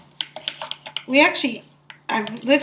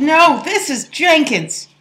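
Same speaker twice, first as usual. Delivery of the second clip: put-on childish retort, higher and louder; replying like a kid; 6 semitones higher, 5 dB louder, slower than usual. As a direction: talk back like a stubborn child. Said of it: "I replied like a kid".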